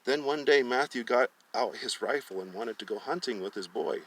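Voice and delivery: plain reading voice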